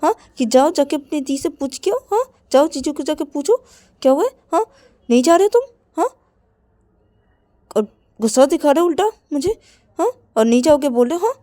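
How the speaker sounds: loud, high, nagging scold; exasperated shouted nagging; angry scolding. Acted. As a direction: scold him for refusing to go. Angry sibling quarrel scolding.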